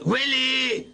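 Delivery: calling Willi from across the house. Real, not acted. Alf calls out to Willi for help. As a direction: shout the name to the next room.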